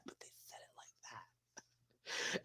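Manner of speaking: breathy whispered aside. Pretend. Vlogger whispers the punchline about the judges' tone. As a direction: whisper it like gossip. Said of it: "They didn't say it like that".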